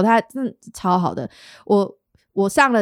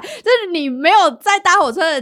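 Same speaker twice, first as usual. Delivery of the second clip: ordinary delivery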